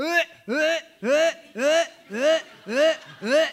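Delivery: put-on high, loud rhythmic baby wails; baby crying. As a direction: imitate a screaming baby in bursts. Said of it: "That's how my daughter Esther cried".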